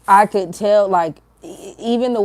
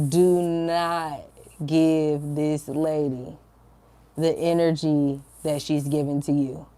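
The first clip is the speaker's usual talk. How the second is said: slow, deliberate repeat with pauses between phrases; firm inner voice; 3 semitones lower, slower than usual. Retold or imitated. Spirit telling her to hold back, slow and firm. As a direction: spell out the instruction slowly, evenly. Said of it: "I felt so strongly in my spirit".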